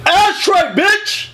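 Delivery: high, punchy mock holler; hammed-up yell. Smoker in bed quoting the movie, hammed-up high yell. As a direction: yell it out, pitch jumping up on both words. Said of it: "I'm just gonna yell that shit out".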